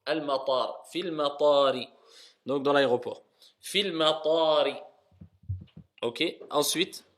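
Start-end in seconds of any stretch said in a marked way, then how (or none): none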